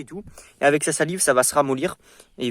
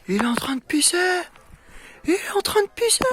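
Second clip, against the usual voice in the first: thrilled high-pitched cry; excited; 11 dB louder than usual. Real, not acted. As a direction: cheer loudly, voice jumping high. Excited the puppy finally pees.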